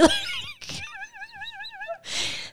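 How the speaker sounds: soft, high squeezed laugh; imitated silent laugh. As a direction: act out a laugh being held in. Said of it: "We're trying to laugh silently".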